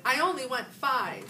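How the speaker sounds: indignant. A narrator's reading. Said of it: "Heidi shouted".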